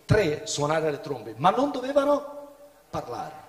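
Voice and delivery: usual speaking voice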